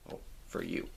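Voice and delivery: whispered correction to the viewers; whispered aside. Real, not acted. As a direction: whisper it as a quick afterthought.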